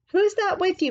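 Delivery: put-on high, creaky old lady asking; old woman's voice. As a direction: peer at the stranger like a nosy old woman. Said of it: "Missus Partridge said".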